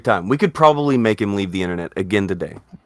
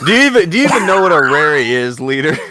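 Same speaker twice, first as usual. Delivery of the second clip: exasperated outburst; 7 semitones higher, 8 dB louder, faster than usual. Real, not acted. Exasperated host grilling co-host.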